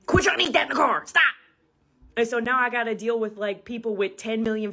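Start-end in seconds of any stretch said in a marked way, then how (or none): 0.0-1.2 mock mom scolding, a bit louder and higher; nagging mom. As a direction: scold the kid like a mom in the car. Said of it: "your mom will be like".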